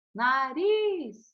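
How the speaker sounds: teacher sounding out "Nariz", lingering on the stressed RIZ; stressed final syllable. Shown as a demonstration. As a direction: draw out the last syllable of "Nariz". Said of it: "todas as sílabas tônicas".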